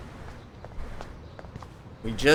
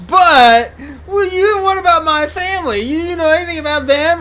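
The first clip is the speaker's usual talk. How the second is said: much higher, louder, crying voice; mock tearful Kate; 10 dB louder than usual. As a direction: whine it out like a tearful girl. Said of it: "And then she goes".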